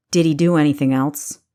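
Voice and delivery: a protective growl held in check. A narrator's reading. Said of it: "he growled dangerously".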